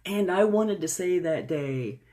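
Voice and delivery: usual speaking voice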